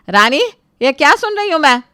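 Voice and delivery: loud, high call then scolding; angry scolding. Acted. Mother-in-law calls Rani out, angry and loud. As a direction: summon her to answer.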